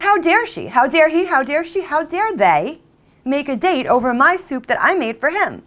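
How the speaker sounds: high, loud indignant outburst; outraged. Acted. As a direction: spit out each question in rising outrage. Outraged rant over betrayal.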